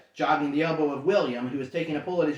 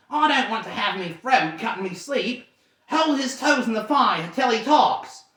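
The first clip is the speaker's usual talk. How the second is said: louder, higher threatening troll; menacing troll; 6 semitones higher, 4 dB louder than usual. Acted. Troll Bert threatening Bilbo, menacing. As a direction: growl the order.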